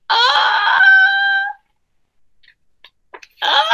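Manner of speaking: high rising ah before a sneeze; sneeze wind-up. Acted. Gerald about to sneeze again, rising high. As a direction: build up to a sneeze.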